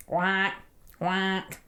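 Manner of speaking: lightly imitated duck quacks. A narrator's reading. Ducks answer Edward from the reeds, quacking. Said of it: "quacked the ducks".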